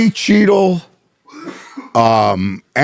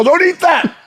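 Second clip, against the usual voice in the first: much higher, squeaky warning; high panicked warning; 13 semitones higher than usual. Retold or imitated. Friend warning his wife about weed chocolate, high and squeaky. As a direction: shriek it again, panicked. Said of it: "The, the, the".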